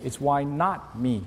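slow "why not me" with "not" leaned on; stress on not. Shown as a demonstration. Pastor dictating the sermon blank, slow stress on "not". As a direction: spell it out word by word, hitting "not". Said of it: "would you fill this in?".

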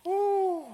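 loud high whoop; cheer. Real, not acted. Pastor whooping to the crowd, a loud cheer. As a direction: let out a celebratory woo. Cheer before point two.

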